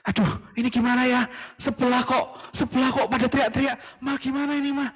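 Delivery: high, fretful voice of a nervous dad; anxious father asking his wife; worried father. Acted.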